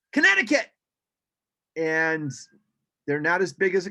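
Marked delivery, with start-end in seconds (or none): 0.1-0.7 high, loud, quick cry of recognition; excited yell. Retold or imitated. Birders mistakenly calling out the bird's name, excited yell. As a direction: blurt it out as a sudden spotting call. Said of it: "quickly yell out".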